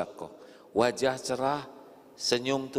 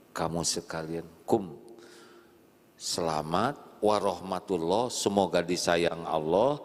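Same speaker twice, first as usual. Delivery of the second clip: normal talking voice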